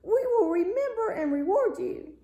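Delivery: high, strange little fish voice, thankful; a small sea creature crying out its thanks, pitched high; grateful fish voice. A narrator's reading. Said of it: "hopped up to it and cried".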